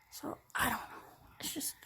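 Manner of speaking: soft resigned whisper. Real, not acted. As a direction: let it fade to a whisper. Trailing off, confused and sad.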